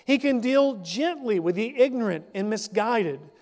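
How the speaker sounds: formal scripture read. Acted. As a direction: read the verse out to the congregation. Reads scripture passage aloud.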